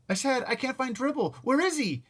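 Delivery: high, loud repeat. Acted. Boy repeating over the noise to mother, loud. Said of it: "I yelled".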